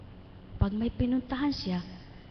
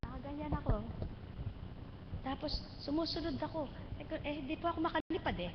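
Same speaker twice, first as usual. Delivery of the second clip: softer, higher, fast near-hush; hushed retelling; 4 semitones higher, 9 dB quieter, faster than usual. Retold or imitated. Retelling her own burial dream, soft and hushed.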